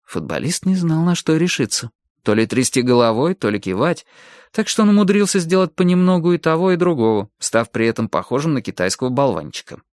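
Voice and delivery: usual speaking voice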